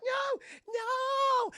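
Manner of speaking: high, loud shrieking no's; hammed-up horror scream; terrified shriek. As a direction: shriek in mock terror. Woman fleeing robot.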